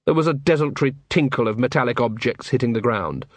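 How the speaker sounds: ordinary delivery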